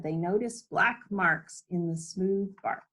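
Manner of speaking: ordinary delivery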